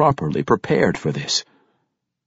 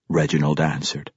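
plain reading voice

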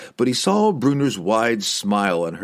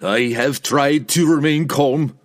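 slow, higher-pitched strained plea; raised voice, slowly protesting; pleading retort; 5 semitones higher, 4 dB louder than usual. A narrator's reading. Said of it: "it seemed more like a plea".

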